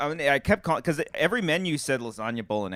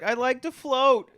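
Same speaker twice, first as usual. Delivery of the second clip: slow, high, dreamy drawl; dreamy slow Amber voice; 10 semitones higher, slower than usual. Retold or imitated. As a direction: float the words out lazily, high. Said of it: "She's like".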